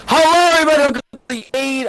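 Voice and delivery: bright, loud stream welcome; upbeat host greeting. Real, not acted. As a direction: greet the viewers with energy.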